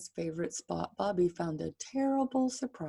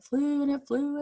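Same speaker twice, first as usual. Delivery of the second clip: higher, building excitement; rising wonder; 7 semitones higher, 6 dB louder than usual. A narrator's reading. Reader builds excitement as the plane flies. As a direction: let it lift with the plane.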